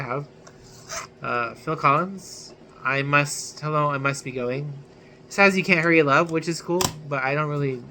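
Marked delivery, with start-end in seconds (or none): none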